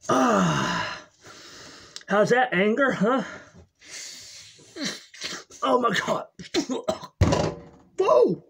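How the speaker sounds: loud, high, wild rush of relief; hammed-up addict riding the high. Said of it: "the drug and alcohol addict".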